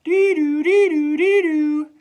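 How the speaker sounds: loud, high two-tone siren wail; fire truck siren imitation. Fire truck zooms by school, loud high siren. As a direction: wail like a passing fire engine. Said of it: "sounding its siren".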